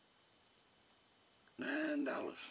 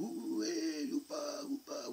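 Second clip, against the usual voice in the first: put-on higher, whooping kid cries; excited hollering; 3 dB louder, slower than usual. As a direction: holler it like a boy dancing around.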